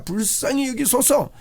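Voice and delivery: high, slow plea of 여기소서; a pleading call, drawn out; pleading cry. Acted. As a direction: cry out for mercy to the Lord. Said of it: "이 부르짖음이 있으면 됩니다".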